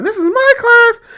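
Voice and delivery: high, drawn-out mocking teacher voice; lightly hammed-up teacher yell. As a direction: parody her as shrill and overdramatic. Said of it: "talking about she's yelling".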